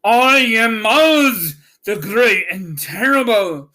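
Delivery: solemn great Oz. A narrator's reading. The hidden wizard announcing himself to the travelers, solemn and booming. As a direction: boom it out like a hidden, mighty wizard. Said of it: "said solemnly".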